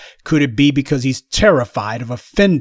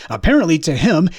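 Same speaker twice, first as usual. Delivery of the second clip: usual speaking voice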